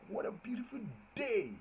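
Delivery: joyful exclaim to the class; exclamatory. Shown as a demonstration. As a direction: marvel at the morning. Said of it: "you see that day is exclamatory".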